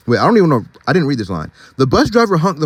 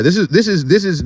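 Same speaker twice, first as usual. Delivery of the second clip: ordinary delivery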